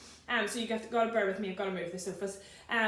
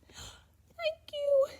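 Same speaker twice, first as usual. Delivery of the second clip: much higher, delighted little thank-you; grateful giraffe. Acted. Giraffe thanking George for the scarf, high and grateful. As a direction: beam at him as the giraffe.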